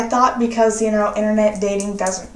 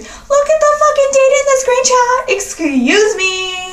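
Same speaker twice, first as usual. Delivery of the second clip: much higher, louder sassy shriek; scolding a cheating boyfriend, high and sassy; sassy outburst; 13 semitones higher, 4 dB louder than usual. Retold or imitated. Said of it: "I have to go sassy there".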